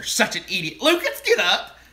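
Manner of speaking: loud, high exasperated scolding; scolding yell. Retold or imitated. Wife scolding her husband in the aisle, loud and high. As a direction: snap at him to get up. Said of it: "I'd always hear".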